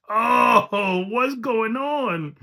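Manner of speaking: high, loud, slow "oh, what's going on"; drawn-out hyped reaction; excited disbelief. Real, not acted. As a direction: stretch it out in delighted shock. Reacting to favourite battle.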